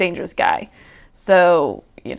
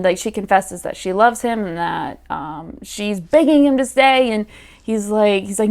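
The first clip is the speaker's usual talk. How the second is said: usual speaking voice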